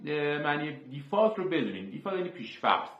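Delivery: normal talking voice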